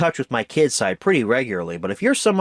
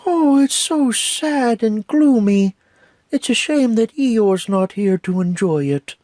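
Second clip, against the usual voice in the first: hammed-up sad lament, much higher; high, slow mock gloom; 10 semitones higher, 3 dB louder than usual. Reviewer quoting a gloomy line, high slow mock sadness. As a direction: sigh it out slowly.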